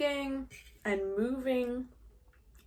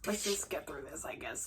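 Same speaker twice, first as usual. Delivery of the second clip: resigned low mutter; 7 semitones lower, 10 dB quieter than usual. Retold or imitated. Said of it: "He's like".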